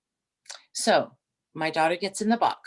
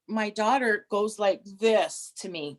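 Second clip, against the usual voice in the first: normal talking voice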